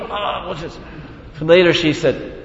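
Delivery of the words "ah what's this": amazed. Retold or imitated. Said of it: "And Yamuna's looking".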